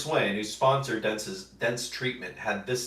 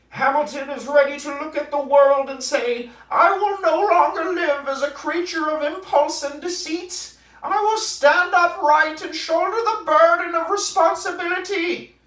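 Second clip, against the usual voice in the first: much higher, louder, solemn lecturing voice in a hammed-up impersonation; Jordan Peterson impression; 8 dB louder than usual. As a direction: ham up a grave, preachy psychologist. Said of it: "It's my best Jordan Peterson impression".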